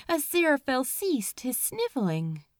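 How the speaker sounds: plain reading voice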